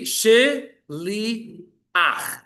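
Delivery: "shili ach" said very slowly, syllable by syllable; teacher sounds it out piece by piece; drawn-out syllables. Shown as a demonstration. Hebrew teacher reading word list, very slow syllables. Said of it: "our first one says".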